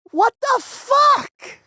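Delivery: high, slow, drawn-out disbelief; stunned disbelief. Real, not acted. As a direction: stretch it out, utterly baffled. Stunned reaction to clip.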